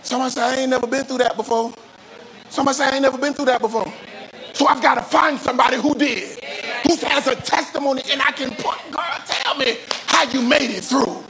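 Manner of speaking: high, urgent preacher's delivery; fervent preaching. Real, not acted. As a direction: drive it home to the congregation, pitched high. Preaching at full force.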